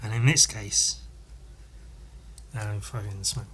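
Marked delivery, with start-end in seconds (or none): none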